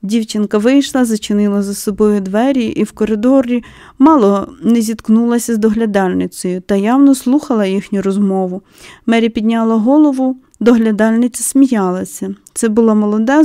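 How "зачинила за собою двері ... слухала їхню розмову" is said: plain reading voice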